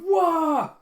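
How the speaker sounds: a loud, high cartoon cry of dismay; dismayed wail. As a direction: yelp it out in dismay. Reaction to field trip.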